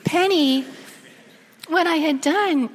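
real choked-up telling; getting teary. Real, not acted. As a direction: struggle through it on the edge of tears. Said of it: "I'm getting teary".

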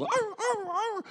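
high yelping seal barks. Retold or imitated. Imitating deaf children barking like seals. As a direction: bark like seals. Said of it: "they start barking like seals".

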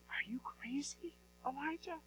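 whispered, rising disbelief; the widow whispering her disbelief to Elijah; incredulous whisper. Acted. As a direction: hiss out the widow's shock in a whisper.

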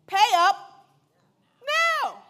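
loud acted demand, pausing before "now"; forceful storyteller's ultimatum; harsh demand. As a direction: demand payment forcefully, word by word. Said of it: "seized him by the throat and demanded".

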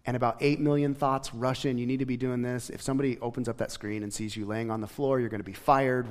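normal talking voice

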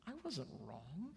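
soft, swooping retort; mild denial. Retold or imitated. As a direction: deny it quietly, as an acted parent. Retold parent answers the child.

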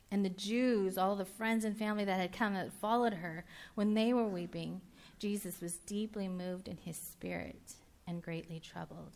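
ordinary talk

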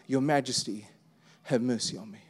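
hushed plea. Retold or imitated. Beggar's plea retold in sermon.

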